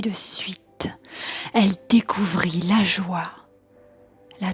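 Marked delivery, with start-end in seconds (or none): none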